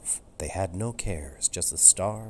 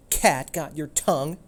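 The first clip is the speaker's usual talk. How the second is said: louder, higher mocking cat voice; taunting; 8 semitones higher, 6 dB louder than usual. Benny the cat mocking fallen Turbo, taunting. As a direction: rub it in, teasing the beaten dog. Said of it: "Benny taunted".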